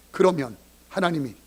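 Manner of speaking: usual speaking voice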